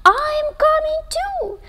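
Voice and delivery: high, small little-brother voice; little brother Monty. Acted. Monty tells his big brother he is coming too, in a high little voice. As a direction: chirp it like a tiny tagalong.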